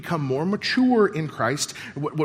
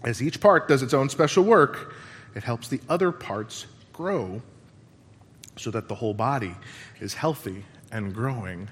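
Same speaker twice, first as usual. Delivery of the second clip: reverent scripture reading; 6 semitones lower than usual. Acted. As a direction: read it gently, letting each phrase settle. Reads scripture, fading reverently.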